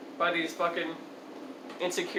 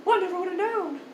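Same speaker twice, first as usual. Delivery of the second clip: very high, put-on voice; hammed-up amazement, pitched way up; mock astonishment; 10 semitones higher than usual. Storyteller imitating the old man's reaction, mock astonishment.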